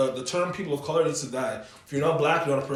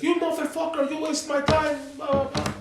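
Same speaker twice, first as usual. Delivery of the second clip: high-pitched imitation of a ranting cabbie; mock angry driver; 10 semitones higher than usual. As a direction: rattle off an indignant cabbie's complaint, far above your usual pitch. Retelling an angry taxi driver's rant.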